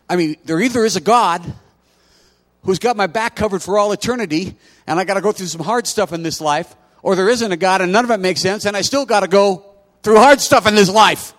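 high, loud fervent preaching; preaching at full force. Acted. Pastor presses the choice of faith on the congregation, preaching hard. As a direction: drive it home to the congregation.

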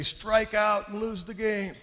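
high, slow heckle from the stands; mock scream. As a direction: imitate a jeering crowd, drawn out. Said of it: "they're screaming at me".

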